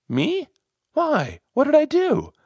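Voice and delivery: high-pitched, incredulous questions; taken aback, voice pitched right up; startled protest. A narrator's reading. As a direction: protest the blame in disbelief. Brian reacts to being blamed.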